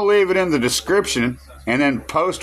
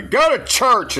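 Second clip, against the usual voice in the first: louder, higher call out; 10 semitones higher, 3 dB louder than usual. Real, not acted. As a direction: call it out to the viewers. Sudden loud preachy call.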